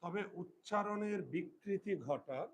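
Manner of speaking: slow, word by word for pupils to write; teacher dictating a rule, slow and spaced; slow dictation. Shown as a demonstration. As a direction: dictate it slowly with pauses.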